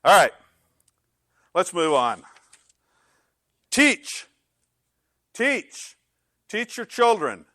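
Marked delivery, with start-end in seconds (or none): 3.7-7.5 high, loud, spaced-out commands; emphatic exhortation. Real, not acted. Preacher urges parents to teach their children, emphatically. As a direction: drive each command home forcefully.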